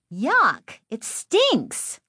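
put-on high-pitched girlish disgust; disgusted declaration. A narrator's reading. Girl declaring that the green gas smells foul, disgusted squeal. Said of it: "Lindy declared".

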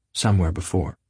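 ordinary delivery, nothing marked

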